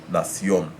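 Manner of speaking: Nación with the final CIÓN stressed; Spanish Nación, weight on the last syllable. Shown as a demonstration. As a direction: land on the Ó in Nación. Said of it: "it's on that last syllable".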